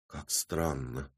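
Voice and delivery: slow, low, very soft mumble; muttered. A narrator's reading. Woman turning away from the men, muttering. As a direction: mumble it to yourself. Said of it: "пробормотала женщина".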